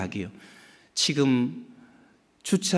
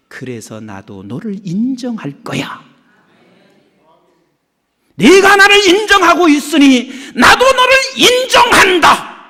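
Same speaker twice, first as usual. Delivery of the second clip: building divine declaration; 10 semitones higher, 11 dB louder than usual. Retold or imitated. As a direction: build from gentle to triumphant. God's declaration quoted, building.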